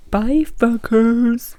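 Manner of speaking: stretched, slow mock-sweet farewell; lingering playful sign-off; drawn-out cheeky goodbye. Real, not acted. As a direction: drag the goodbye out teasingly.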